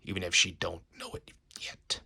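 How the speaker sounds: low, hushed scheming whisper. Acted. Villain plotting over his captive, whispering. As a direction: murmur it half to yourself.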